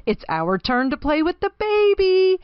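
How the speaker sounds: excited call, climbing high; rally the others with rising eagerness; eager animals. A narrator's reading. Zoo animals getting their turn with the baby, eager.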